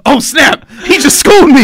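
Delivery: loud, high, amused outburst; stunned reaction. Retold or imitated. Young fan reacts to legend's put-down, loud and stunned. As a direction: yelp it, floored at being schooled.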